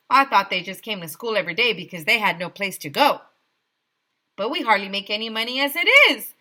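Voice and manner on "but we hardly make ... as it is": loud, fast protest; teacher yelling in protest; yelled protest. A narrator's reading. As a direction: blurt out the complaint. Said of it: "yelled Miss Yonkers".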